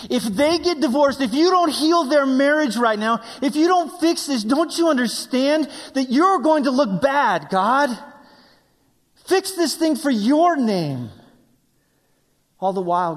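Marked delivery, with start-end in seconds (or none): 0.1-11.1 high, loud re-enacted prayer; desperate pleading. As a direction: argue with God at raised pitch. Re-enacting desperate prayer for parents' marriage.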